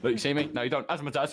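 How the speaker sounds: high, loud showman flourish; theatrical magician. Pretend. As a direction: announce it like a stage magician. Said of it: "I sound like a magician".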